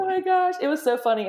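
high, bubbly oh my gosh; giddy excitement. Real, not acted. Guest reacting to the host's news, high giddy excitement. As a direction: squeal it out with delight. Guest laughing about the surprise.

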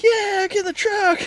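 very high, slow, silly dog voice; goofy bird dog voice. Retold or imitated. Owner voicing his bird dog answering a command, very high and silly. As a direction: squeak it like a dopey eager pup. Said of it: "and the bird dog's like".

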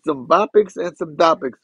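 ordinary talk, nothing marked

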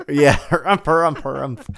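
loud blustery harumphs; harumphing. Retold or imitated. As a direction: give a stuffy old-man harumph. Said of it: "Did I get a harumph out of that guy".

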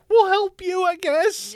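very high, loud squeaky voice; squeaky comic stand-in for a country; mock reluctant helper. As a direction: offer help in a silly falsetto. Croatia voiced as a timid helper.

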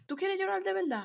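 imitating a mother daring her kid to cry; mock angry mom. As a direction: snap it like a fed-up mom. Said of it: "it was like".

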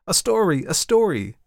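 high, loud eager demand; children begging, voices up high; excited cries. Acted. Children pulling the man to the tree, loud and eager. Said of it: "cried the children".

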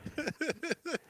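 high, soft giggle. Real, not acted. Laugh after catchphrase.

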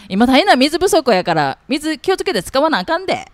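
conscious Kansai uncle. Shown as a demonstration. Retrying on purpose to nail the Kansai uncle, exaggerated Kansai dialect. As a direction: lay the Kansai intonation on thick. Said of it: "ちょっと自分で意識しながら".

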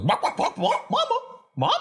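high squeaky barks and cries; squeaky chicken voice. Acted. The chicken calling the party over, high and squeaky. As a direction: yap it out in a tiny high chick voice. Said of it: "Carrot comes out and goes".

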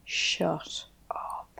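awed whisper of disbelief. Real, not acted. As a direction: breathe it in amazement. Amazed at statement count.

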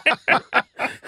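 high, bright burst of laughter. Real, not acted. Host laughs at the story.

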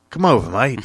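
British sliding into Australian; badly faked Australian mate; bad Australian accent. Inviting a London friend over, bad Australian accent. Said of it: "go from British to Australian".

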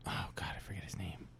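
unvoiced hushed aside; whisper. Real, not acted. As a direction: whisper it under your breath.

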